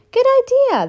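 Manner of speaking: very high, loud delight; delighted agreement. A narrator's reading. Big skeleton loves the new game, in a high loud squeal. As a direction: squeal with glee. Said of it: "the big skeleton said".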